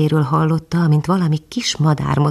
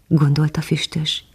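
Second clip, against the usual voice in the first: plain reading voice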